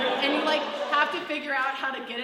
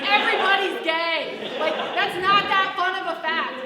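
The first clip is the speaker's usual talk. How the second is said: exasperated retort; 7 semitones higher, 11 dB louder than usual. Retold or imitated. To the class about their fun facts, a loud, high exasperated retort. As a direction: boom it out, fed up with the fun facts. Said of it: "And I was like".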